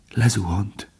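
hushed, understated fearful voice; trembling. A narrator's reading. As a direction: say it softly, with a slight tremble. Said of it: "mondta Bambi anyja remegve". (halkan, kissé remegve)

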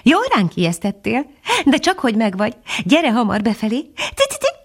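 high, lilting bird voice; tinkling titmouse. A narrator's reading. Titmouse scolding and welcoming its friend, high tinkling voice. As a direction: chirp it brightly like a little tit. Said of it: "csilingelt a cinege".

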